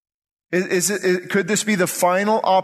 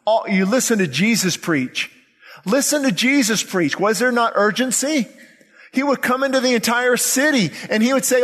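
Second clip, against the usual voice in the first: urgent sermon building higher in pitch; impassioned preaching; 6 semitones higher than usual. Acted. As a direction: drive home the urgency to the congregation. Preacher builds toward impassioned retelling.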